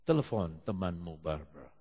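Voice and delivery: low, measured voice falling away; Holy Spirit's instruction. Retold or imitated. The Holy Spirit directs someone in morning prayer, low and calm. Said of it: "Roh Kudus bilang sama dia".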